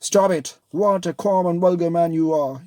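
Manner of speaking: loud, high furious rebuke; angry outburst. Acted. Wife lashing out at her husband, angry shout. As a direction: snap at him in disgust.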